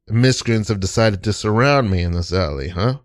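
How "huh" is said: ordinary talk, nothing marked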